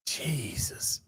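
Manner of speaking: breathy exasperated whisper; barely-voiced sigh of Jesus. Real, not acted. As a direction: mutter it under your breath in disbelief.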